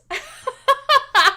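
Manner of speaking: high loud cackling laugh; cackle. Real, not acted. Streamer cackling at her own Granny Crim joke.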